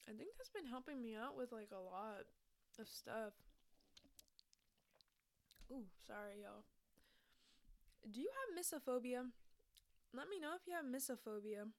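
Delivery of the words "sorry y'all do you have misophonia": ordinary talk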